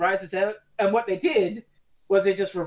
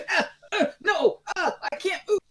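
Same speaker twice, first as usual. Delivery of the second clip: high, hammed-up cries of struggle; mock helpless yelps, much higher pitched; actor's mock struggle; 12 semitones higher than usual. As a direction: imitate an actor pretending to be dragged. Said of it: "the actors moving forward, going".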